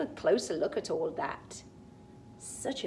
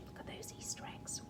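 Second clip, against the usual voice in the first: whisper; 17 dB quieter, faster than usual. Real, not acted. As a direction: whisper it like sharing a secret. Whispering to child about picture.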